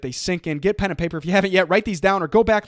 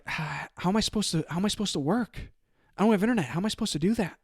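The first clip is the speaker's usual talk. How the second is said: calibrated question; 3 semitones higher, 6 dB quieter than usual. Retold or imitated. Asking the internet company rep for help, soft and puzzled. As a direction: ask as if honestly stuck, gently. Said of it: "he asks calibrated questions".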